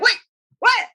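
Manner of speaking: high startled squeak; shocked. Retold or imitated. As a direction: jump up in pitch, stunned. Servants shocked by her plan.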